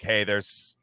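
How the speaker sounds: normal talking voice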